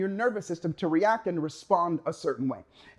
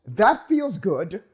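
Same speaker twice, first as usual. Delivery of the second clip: the brain's pleased voice; 3 dB louder, slower than usual. Retold or imitated. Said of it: "the brain goes".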